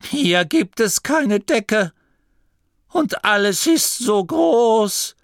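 put-on high, slow, awed old voice; a frightened abbot staring at the huge open sky; trembling with fear. The abbot trembles under the open sky, high and slow. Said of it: "Gurda zitterte".

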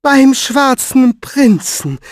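high, loud, slow disbelief; incredulous, stretched-out question; astonished echo. Acted. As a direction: draw out each word in amazement. She echoes the news, astonished.

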